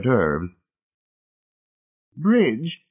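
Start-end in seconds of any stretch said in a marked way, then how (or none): 2.2-2.8 high, emphatic matron; high-pitched, emphatic call. A narrator's reading. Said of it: "saying emphatically".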